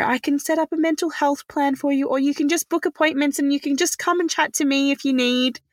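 a lightly voiced caring doctor; imitating kind doctor. Doctor offering support.

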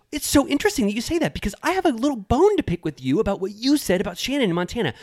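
high, sing-song mock Tamara, speeding at the end. Host mimics Tamara calling out Heather, high and syrupy. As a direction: put on her syrupy gotcha voice. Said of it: "And she goes".

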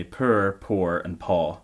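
Scottish "poor", "poor", "paw": oo-R, oh-R, open aw; three different pronunciations. Shown as a demonstration. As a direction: keep "poor", "poor" and "paw" distinct, R rolled into the first two. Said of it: "Three different pronunciations".

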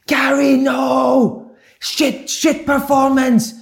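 Di Canio raging. Retold or imitated. Manager storms in after a win, shouting at Gary. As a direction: rage at the player as he bursts in. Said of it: "you could hear him running down the corridor".

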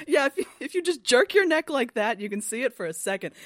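high, loud eager explanation; Tepic voiced. Friend voicing Tepic explaining the crack, high eager voice. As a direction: excitedly show how to jerk your neck. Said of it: "He's like".

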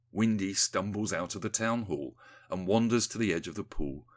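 usual speaking voice

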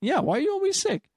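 squeaky mock question; high mocking voice. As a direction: pitch it way up, mocking. Repeats friend's mock line.

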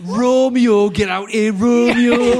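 put-on pirate accent. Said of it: "Shakespeare was a drunk pirate".

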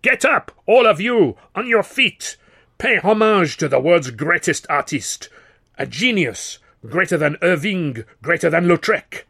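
rally the whole theatre to its feet; booming stage shout of praise. A narrator's reading.

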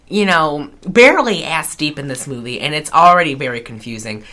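ordinary delivery

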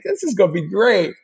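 a hint of a kid's excitement; gleeful nerd. Picturing the Death Star, gleefully eager. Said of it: "the nerd in me is like".

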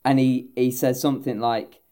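ordinary talk

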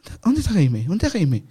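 loud, high-pitched mock calling out; urgent searching call. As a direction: imitate someone urgently hunting for a person. People urgently looking for Jaime.

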